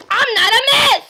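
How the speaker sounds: high, loud play-acted outburst; indignant correction. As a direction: object hotly to the wrong title. Indignant at being called miss.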